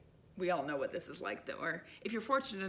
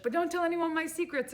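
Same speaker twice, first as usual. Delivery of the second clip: higher, louder conspiratorial joke; playful old lady; 9 semitones higher, 6 dB louder than usual. Retold or imitated. Old lady at the checkout joking to the narrator, playful and loud. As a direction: tease like a sweet old lady sharing a secret.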